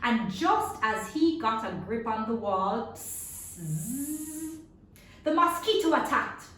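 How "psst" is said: ordinary delivery, nothing marked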